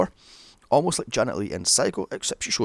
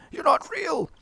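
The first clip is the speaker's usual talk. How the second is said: high-pitched mock outburst; hammed-up high exclamation; acted movie villain. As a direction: throw the line out in a high, put-on voice. Acts out Chucky's line while slicing.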